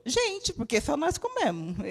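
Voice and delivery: real laughter at own joke; laughing. Real, not acted. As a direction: joke at ourselves and crack up.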